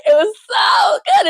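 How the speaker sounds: very high, crying-laughing gush; gushing delight. Real, not acted. Host raving about a scene, high gushing.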